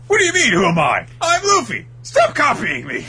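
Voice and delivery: high, loud, fast indignant yell; angry Luffy shout. Acted. Luffy yelling at his mirror double, high indignant shout. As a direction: yell it at the copycat.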